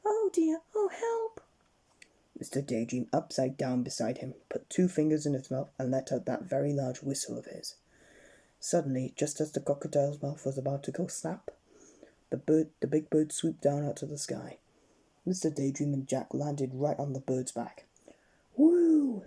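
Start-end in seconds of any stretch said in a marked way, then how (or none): 0.1-1.4 high, rising cry for help; panicked cry. Acted. Jack facing crocodile's teeth. 18.6-19.2 high, loud whoop; whoop of joy. Acted. Jack rescued onto bird.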